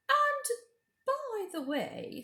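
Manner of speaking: high, sweet coaxing queen; high, coaxing witch-queen voice; queen's voice. Acted. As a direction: lure the boy in with a high honeyed voice. Queen speaks.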